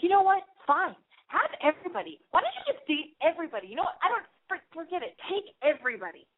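high-pitched mock tantrum; retold blow-up. Telling her girlfriend to take every guy, high retold tantrum. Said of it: "I just blew up at her".

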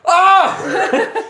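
high startled yelp with a laugh; panicked yelp. Acted. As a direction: yelp in fear. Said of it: "He's like".

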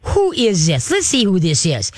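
put-on high, girly dog-greeting voice; girly baby talk. Shown as a demonstration. Trainer shows owner how to introduce a visitor, girly baby talk. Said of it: "girly baby talk voice".

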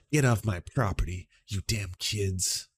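ordinary talk, nothing marked

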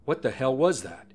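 an understated cry of disbelief; mild narrated shout. A narrator's reading. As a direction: read it as a contained shout. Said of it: "Conklin shouts".

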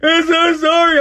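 very high, wailing imitation; mock whining. Mom looking for sympathy from son, mock whining. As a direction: whine and wail it, hammed-up. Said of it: "Whining like".

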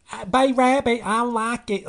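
high, loud, badly faked Southern accent; Kim Basinger. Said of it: "these accents are getting really bad".

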